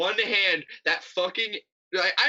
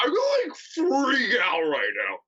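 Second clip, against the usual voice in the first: mock Josh; 6 semitones higher than usual. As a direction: parody of a melodramatic reality star. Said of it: "he literally sounds like the Nick Kroll publicity character".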